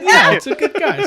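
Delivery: high, loud laughing Canadian yell; mock Canadian shout. The officers laughingly yelling back in mock Canadian. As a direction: blurt it out as a jolly Canadian. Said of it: "And they said".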